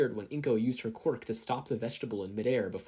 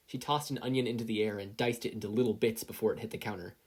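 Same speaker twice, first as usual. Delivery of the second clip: ordinary delivery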